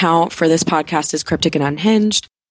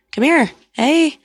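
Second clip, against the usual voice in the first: high coaxing call to a pet; calling the cat; 10 semitones higher, slower than usual. Real, not acted.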